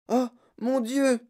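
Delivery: put-on high, slow child's exclamation; alarmed cry. A narrator's reading. Charlie watches the Salts fall, alarmed cry. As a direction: cry out high, without much volume. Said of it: "cria Charlie".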